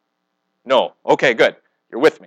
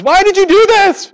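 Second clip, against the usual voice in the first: much higher, louder indignant demand; angry Pharaoh; 7 dB louder than usual. Retold or imitated. Pharaoh confronting Abraham about his lie, in a high indignant yell. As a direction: Pharaoh yelling at Abraham, mock outrage. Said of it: "And Pharaoh says".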